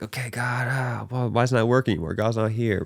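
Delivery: lightly voiced sulky doubter talking to God; mock let-down believer. Imitating a disappointed believer.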